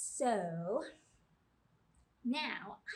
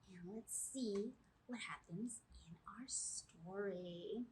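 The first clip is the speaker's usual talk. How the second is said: slow soft whisper; 6 dB quieter, slower than usual. Acted. Librarian whispers to the children, slowly. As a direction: hush them in close.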